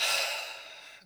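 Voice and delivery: airy sigh without voice; demonstrated out-breath. Shown as a demonstration. Meditation guide pacing breath through nose and mouth, sighing out. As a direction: exhale audibly through the mouth. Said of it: "In through the nose".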